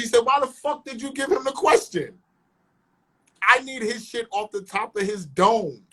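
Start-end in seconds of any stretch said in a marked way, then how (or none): 0.2-2.2 high-pitched, exasperated mock outrage; retold indignant demand. Show owner challenging whoever leaked the question, retold indignation. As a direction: imitate an annoyed boss, pitch pushed up.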